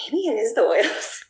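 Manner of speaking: half-laughing mock astonishment; dawning surprise. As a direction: let it dawn on you with a lift of wonder. Retold sudden realization about oils.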